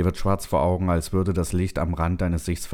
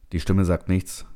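plain reading voice

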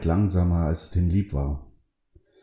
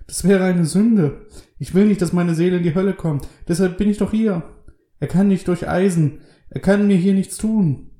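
high, agitated, frightened voice; panicked high-pitched outpouring; terrified; 13 semitones higher, 5 dB louder than usual. A narrator's reading. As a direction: insist desperately that it's safe here. Terrified prisoner fears for his soul.

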